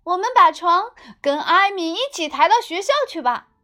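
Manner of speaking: put-on high, loud eager boy; little brother voice. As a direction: pitch the idea like an excited kid. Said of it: "艾米的弟弟建议".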